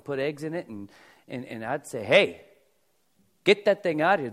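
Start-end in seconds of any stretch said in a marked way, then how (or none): 2.0-4.3 loud, higher mock scold. Pastor acts telling Daniel off over the dog dish, loud mock scold. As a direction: bark it like catching someone at the dog dish.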